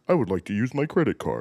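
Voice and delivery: a stiff, overly formal voice in a different timbre; a polite customer in a famous old video, voiced stiffly; imitating a video. Quoting favorite video.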